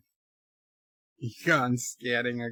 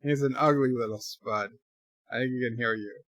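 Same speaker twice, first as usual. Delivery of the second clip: normal talking voice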